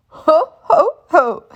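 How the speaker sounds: jolly hearty chuckle; dad laughing as the tail fits; chuckling. A narrator's reading. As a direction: chuckle pleased with yourself. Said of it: "chuckles Daddy Pig".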